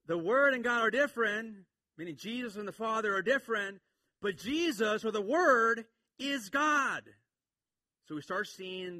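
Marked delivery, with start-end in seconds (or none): 0.1-7.0 loud, high, emphatic proclamation; full-force preaching. Real, not acted. Preacher explains the verse to congregation, forcefully. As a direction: hammer the point home to the congregation.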